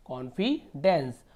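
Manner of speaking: Confidence slowly as "konfi - dens"; teacher sounding out confidence in pieces; broken into parts. Shown as a demonstration. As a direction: pause before "dens" in confidence. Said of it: "तोड़ तोड़ के पढ़िए".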